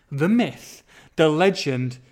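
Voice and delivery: slow, booming build to a huge shouted name; grand announcer intro. Pretend. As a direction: announce him like a boxing ring MC.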